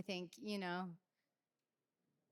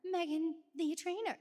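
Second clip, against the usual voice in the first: imitating Chris; 9 semitones higher, 6 dB louder than usual. Singer imitating her best friend hyping her up, high and gushing. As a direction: gush like an adoring best friend. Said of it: "And he is like".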